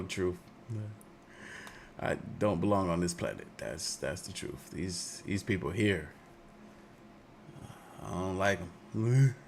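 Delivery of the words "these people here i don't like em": ordinary talk, nothing marked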